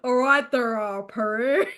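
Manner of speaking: loud, put-on northern greeting; lightly hammed-up Liverpool hello; bad regional accent. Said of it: "don't try regional accents".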